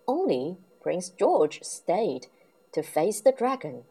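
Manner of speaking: ordinary delivery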